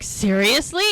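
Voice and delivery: mild retold disbelief; stunned. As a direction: react like you can't believe it. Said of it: "they're both, like, stunned".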